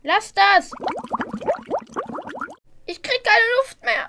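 high, panicked struggling cry. Acted. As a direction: panic as you're held down. Boy held down in toilet.

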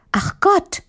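put-on high, dismayed little-girl voice; high-pitched childlike dismay; little girl Marie. A narrator's reading.

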